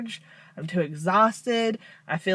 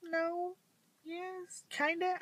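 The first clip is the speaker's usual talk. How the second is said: much higher and softer, flustered; flustered squeak; 12 semitones higher, 9 dB quieter, slower than usual. Retold or imitated. To people, flustered unsure reply about Crohn's. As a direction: stammer the answers in a tiny high voice. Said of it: "And I'm like".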